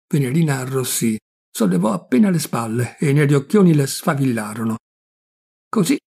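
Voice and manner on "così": innocent mischief. A narrator's reading. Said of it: "disse con ingenua malizia".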